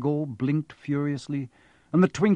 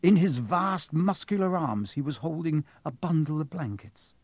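ordinary delivery, nothing marked

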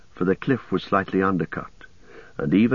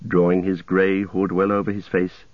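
plain reading voice

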